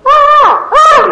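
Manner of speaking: loud, high imitated crow cry; crow squawk.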